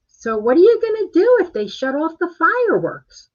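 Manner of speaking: exaggerated high-pitched imitation of sister; mock sister voice. Sister asking about the fireworks while moving in, mock high voice. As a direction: put on a silly high voice, mocking your sister. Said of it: "She doesn't sound like that".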